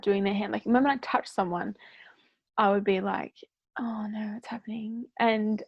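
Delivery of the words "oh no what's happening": hushed alarm. Retold or imitated. Retold panic at touching clients.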